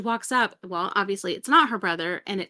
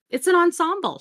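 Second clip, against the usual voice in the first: higher, louder, prim little declaration; mock proud quote; 8 semitones higher, 6 dB louder than usual. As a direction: announce the outfit with prim pride. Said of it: "she says".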